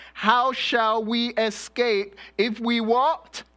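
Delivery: rising call to the crowd, waiting; call and response. Real, not acted. Preacher prompting congregation response.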